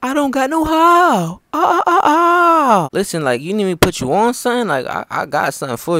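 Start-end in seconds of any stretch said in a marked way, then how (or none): 0.0-2.9 high, drawn-out wailing delivery; high comic character voice. Acted. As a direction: draw out the last sounds into wailing ahs.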